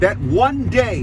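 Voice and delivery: slow, heavy stress on "one"; slow stress on one day. Real, not acted. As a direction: lean hard on "That one day". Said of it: "I put the emphasis on that".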